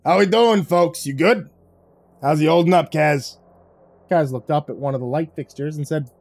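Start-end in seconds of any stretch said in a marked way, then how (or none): 0.0-3.4 booming. A narrator's reading. Vic checking in over the speaker, booming. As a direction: boom it over the intercom. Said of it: "Vic's voice boomed".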